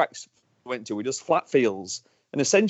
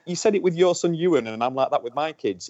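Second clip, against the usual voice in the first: usual speaking voice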